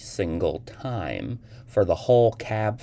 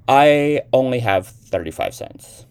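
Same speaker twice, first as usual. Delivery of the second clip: coldly; 7 semitones higher, 10 dB louder than usual. Acted. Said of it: "she said coldly".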